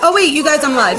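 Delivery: real surprise breaking into the stream; startled. Real, not acted. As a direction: blurt it out as it hits you. Sudden realisation on stream.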